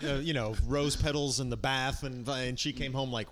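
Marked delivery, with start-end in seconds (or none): none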